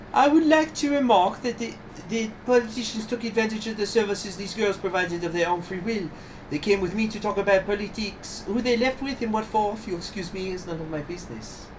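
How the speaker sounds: high-pitched, composed lady's voice; composed accused madame. Acted. Brothel keeper defending herself before the judge, high composed voice. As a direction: defend yourself in a high, proper voice.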